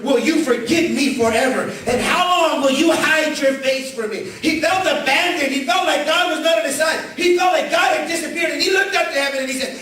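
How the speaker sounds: impassioned preacher's wail; preaching at full force. Acted. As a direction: cry the psalm up to heaven. Preaching psalm lament at full force.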